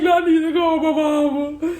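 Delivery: high, loud tearful plea; imitating a tearful outburst; crying again. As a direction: sob out the demand to leave. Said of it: "I broke down crying again".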